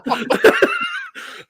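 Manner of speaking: high-pitched burst of laughter; laughing hard at the memory. Real, not acted. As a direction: crack up at your own punchline. Laughing at own story.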